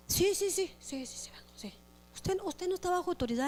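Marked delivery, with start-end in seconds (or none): 0.1-1.7 high mock yes-yes, fading away; dismissive agreement. Member brushing off authority, dismissively agreeing. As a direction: rattle off agreement and let it drop.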